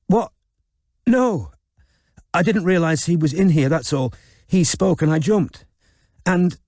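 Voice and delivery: put-on high, rushed, embarrassed young woman; Robin flustered. Robin to Chisel, denying anything happened, flustered. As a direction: blurt it out, caught off guard and blushing.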